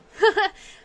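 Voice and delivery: high, loud burst of laughter. Real, not acted. Laughs at shooting.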